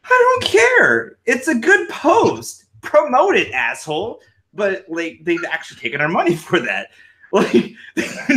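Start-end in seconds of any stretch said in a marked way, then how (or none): 0.0-4.2 high, slow, indignant retort pitched way up; exasperated mock rant at Facebook; mock outburst. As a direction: snap back at Facebook in exasperation. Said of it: "And it's like".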